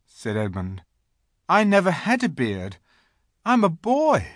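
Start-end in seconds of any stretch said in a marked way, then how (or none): 1.5-4.3 put-on high-pitched earnest boy's voice; protesting boy. A narrator's reading. Edmund explains himself to the Queen, protesting.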